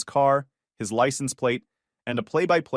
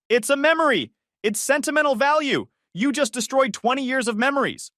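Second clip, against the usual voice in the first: very high, near-hysterical protest; shrieking outrage; 12 semitones higher, 3 dB louder than usual. Acted. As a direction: wail about lost memories. Joyce unhinged.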